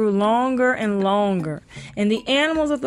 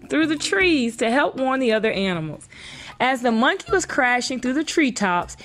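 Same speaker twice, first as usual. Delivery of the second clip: ordinary delivery, nothing marked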